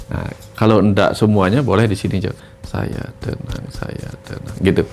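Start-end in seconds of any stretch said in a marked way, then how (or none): 2.6-4.6 slow, much softer, breathed whisper; calming whisper. Shown as a demonstration. Coach showing a viewer calming self-talk, slow whisper. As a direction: whisper it to calm the body. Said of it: "beri perintah kepada tubuh untuk menenangkan dirinya".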